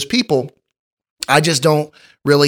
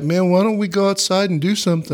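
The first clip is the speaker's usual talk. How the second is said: higher-pitched voice for a pet dog; imitated dog asking its owner; eager dog; 5 semitones higher than usual. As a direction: voice a dog pleading to play. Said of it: "dogs are always looking at you like".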